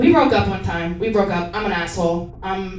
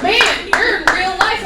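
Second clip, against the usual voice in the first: friends' mocking voice; 9 semitones higher, 6 dB louder than usual. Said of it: "They're like".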